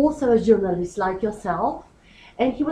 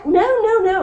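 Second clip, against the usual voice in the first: higher, louder imitated objection; emphatic retold denial; 10 semitones higher, 5 dB louder, much faster than usual. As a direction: quote a firm, insistent refusal. Retelling an interviewee's emphatic denial.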